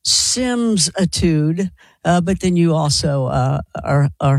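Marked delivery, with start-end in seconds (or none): 0.0-1.7 very slow, stretched-out word; slow, deliberate naming; drawn-out coinage. Real, not acted. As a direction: draw the made-up word right out. Coins a playful word.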